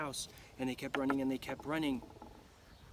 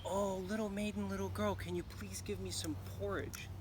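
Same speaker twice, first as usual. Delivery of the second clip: higher, pleading little cub voice; little bear cub; 5 semitones higher, 4 dB quieter than usual. Acted. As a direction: beg sweetly like a hungry cub.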